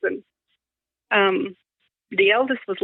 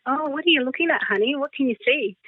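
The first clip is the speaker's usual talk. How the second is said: gentle coaxing; 7 semitones higher than usual. Retold or imitated. Mum coaxing toddler gently.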